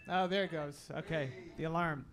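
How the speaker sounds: ordinary delivery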